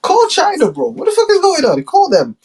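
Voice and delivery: very high, frantic yelling; cartoonish high-pitched tantrum; mock Trump. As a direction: squeal orders in a panic. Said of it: "Trump is like".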